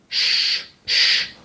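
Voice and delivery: whispered soft sh. Shown as a demonstration. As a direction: hush like quieting someone. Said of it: "como cuando calles a alguien". (sh suave, como cuando callas a alguien)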